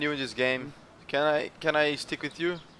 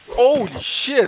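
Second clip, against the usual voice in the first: amazed exclaim; 11 semitones higher, 12 dB louder, much slower than usual. Real, not acted. Watching a fight.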